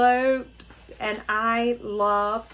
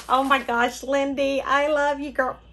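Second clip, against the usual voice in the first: louder and higher, gushing back at her friend; thrilled; 4 semitones higher, 5 dB louder than usual. Real, not acted. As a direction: answer the card with delighted gushing. Answers the card back, thrilled.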